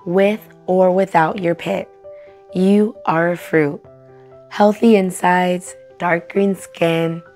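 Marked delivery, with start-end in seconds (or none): none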